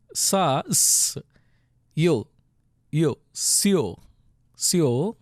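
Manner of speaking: "sa", "s", "yo" separately, then blended "sio"; sounding out syllable. Shown as a demonstration. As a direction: build "sio" from held single sounds with pauses. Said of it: "Look at the first syllable".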